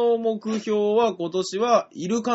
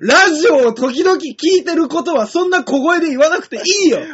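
higher and louder, laughing disbelief; incredulous protest; 9 semitones higher, 7 dB louder than usual. Real, not acted. Laughing protest at shy fan.

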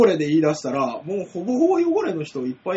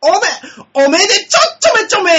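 much higher and louder squeaky repeat of おめでちょちょめちょめ; cutesy echo; 12 semitones higher, 9 dB louder than usual. Pretend. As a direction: squeak the pun back even cuter. Echoes the cutesy pun.

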